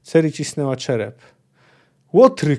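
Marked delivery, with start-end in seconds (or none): 2.1-2.6 loud, raised cry of outrage; shout into the night. Acted. Ciri shouting into the stormy night, loud and outraged. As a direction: hurl the word out at full voice. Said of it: "krzyknęła w noc".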